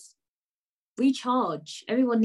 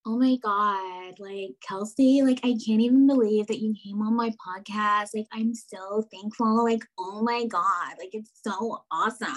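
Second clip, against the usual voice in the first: put-on American Valley girl accent. Shown as a demonstration. Host showing off her American accent to her guest, gushing Valley girl. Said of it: "my American accent does come out sometimes".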